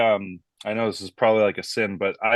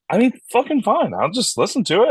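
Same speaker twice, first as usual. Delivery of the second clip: exasperated; 12 semitones higher, 4 dB louder than usual. Retold or imitated. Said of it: "I was like".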